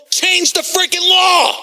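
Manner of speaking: ranting demand; angry shout. Real, not acted. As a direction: yell it at the officials in outrage. Angry rant against officials.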